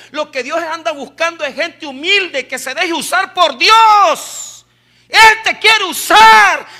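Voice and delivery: building to a high, emphatic shout; full-force preaching. Real, not acted. Preacher tells congregation God will use them, shouted fervent preaching. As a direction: hammer each phrase home loudly.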